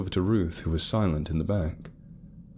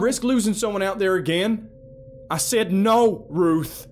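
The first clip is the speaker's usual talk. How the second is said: louder, harsh shout; angry snap; 6 dB louder than usual. A narrator's reading. Felton snapping angrily at his daughter. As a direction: snap at her in anger. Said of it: "he snapped".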